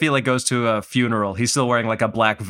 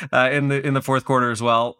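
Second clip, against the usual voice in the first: usual speaking voice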